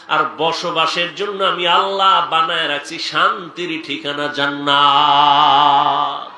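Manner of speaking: high, ringing cry settling into a drawn-out chant; full-force preaching. Acted. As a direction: preach it out, stretching the final word long.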